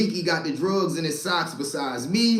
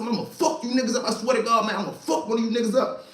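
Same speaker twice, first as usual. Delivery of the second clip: high, fast angry yelling; fast furious threat; 6 semitones higher, faster than usual. Retold or imitated. Banging the cell door at the informants, fast and furious. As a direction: keep threatening, rushing.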